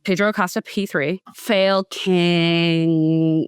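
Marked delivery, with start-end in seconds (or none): 1.4-3.5 mocking title. Real, not acted.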